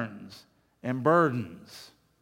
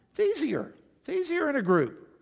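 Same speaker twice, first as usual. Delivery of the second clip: high emphatic; 11 semitones higher than usual. Acted.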